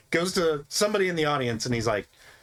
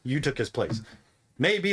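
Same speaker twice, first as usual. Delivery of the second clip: normal talking voice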